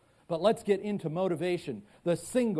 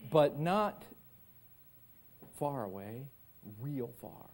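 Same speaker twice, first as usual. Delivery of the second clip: ordinary delivery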